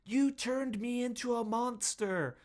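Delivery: high-pitched melodramatic cry; mock scream. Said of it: "while she screams".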